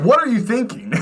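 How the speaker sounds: high-pitched incredulous question; hammed-up exasperated squeal; mock scolding. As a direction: demand an answer in comic disbelief. Repeats scolding of childhood self.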